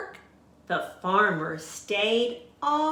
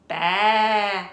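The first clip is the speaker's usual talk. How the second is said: loud bleating sheep; sheep baa; 7 dB louder than usual. A narrator's reading. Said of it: "said the sheep".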